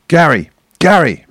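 shouting from the touchline. Retold or imitated. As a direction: yell it across the pitch. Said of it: "starts shouting".

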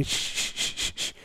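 soft unvoiced shush; steady gentle shushing of a newborn. Shown as a demonstration. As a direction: shush the baby to sleep. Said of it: "I'm shushing".

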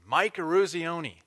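loud, high shout of his name; yelling a name. Retold or imitated. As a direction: call it out across the ice. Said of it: "one of the guys yells out his name".

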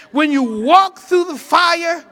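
loud, rising to a shout on the fire; shouted preaching climax. Real, not acted. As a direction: preach it out at full force, slow on the last lines. Climax of the verse.

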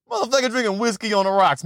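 ordinary delivery

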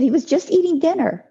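high, disbelieving retort; bewildered protest. Retold or imitated. Mother protesting to the neighbor at the dock, bewildered. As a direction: insist it can't be true. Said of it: "I said".

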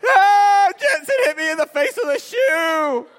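high, loud wailing complaint; crying little brother. Retold or imitated. Little brother to parents, high wailing. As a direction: whine it like a hurt toddler.

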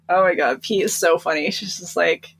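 usual speaking voice

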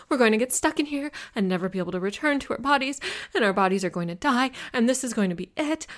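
high, loud, rushed panic building to broken-off words; hysterical, breathless dread; spiraling panic. A narrator's reading. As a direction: spill it out frantically, climbing as you go. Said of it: "my panic spiraling higher and higher".

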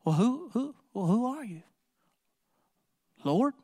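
halting, stammered question, voice changed; a man on the ground, shaken and unsure; frightened stammer, pitch up; stunned and fearful. Acted. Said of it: "how do you think those words came out of his mouth".

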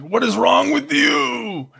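high, slow shout of a fed-up parent; exasperated yell. Retold or imitated. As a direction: yell it at the kids, stretching every word. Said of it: "I'm like".

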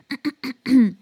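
a short, deliberate throat clear. Shown as a demonstration. Vocal coach showing students the throat clearing to avoid, harsh throat clear. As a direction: clear your throat to show the bad habit. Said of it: "je keel schrapen".

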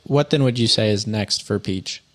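ordinary delivery

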